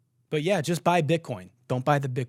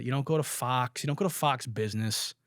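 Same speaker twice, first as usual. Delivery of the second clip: usual speaking voice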